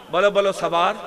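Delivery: ordinary talk, nothing marked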